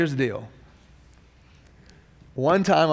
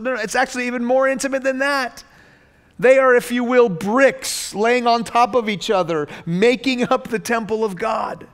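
voicing the apostle, more emphatic; 8 semitones higher, 4 dB louder than usual. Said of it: "he stops and says".

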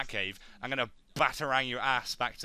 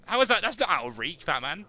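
mock scold; 5 semitones higher, 6 dB louder than usual. Real, not acted. Scolding the game character.

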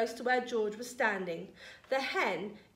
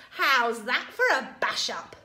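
loud crowing grandma; shouting; 7 dB louder than usual. A narrator's reading. Grandma boasting from the roof to George, mildly shouted. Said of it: "she shouted".

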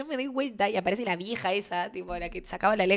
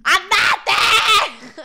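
loud, high yell with laughter behind it; demonstrated shout; 11 semitones higher, 8 dB louder than usual. Retold or imitated. Showing how the two friends yelled at the guy, loud shout. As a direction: scream the order, re-enacting it. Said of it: "Real, le gritamos así".

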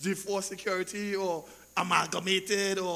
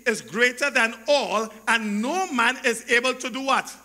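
loud, emphatic scripture proclamation; impassioned preacher in full cry; full-force preaching; 4 semitones higher, 9 dB louder than usual. Real, not acted. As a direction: drive each phrase home to the congregation.

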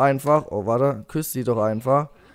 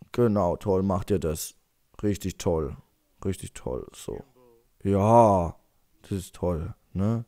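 soft, low, soothing murmur; hushed praise; 7 semitones lower, 7 dB quieter than usual. Pretend. Player praises game parents feeding baby, softly approving. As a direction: praise gently under your breath.

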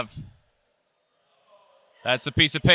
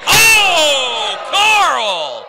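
high, loud, astonished call; excited commentator shout; 6 semitones higher, 5 dB louder, slower than usual. Real, not acted. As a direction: yell it out in disbelief. Call of a top-rope superplex.

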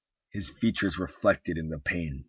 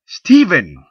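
"Stephen!" much higher and louder, snapped; sharp snap; 10 semitones higher, 17 dB louder than usual. Acted. Wife snapping her husband out of his reverie, sharp loud call. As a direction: snap his name to break his daydream. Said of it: "Her tone snapped him from his reverie".

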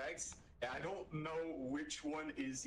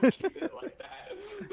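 much higher and louder, cracking with laughter; laughing hard; 11 dB louder than usual. Real, not acted. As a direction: squeal the words through helpless laughter. Repeated in breaking laughter.

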